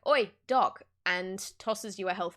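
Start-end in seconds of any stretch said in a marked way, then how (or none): 0.1-0.8 in-character call. Acted. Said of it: "Charlie goes like".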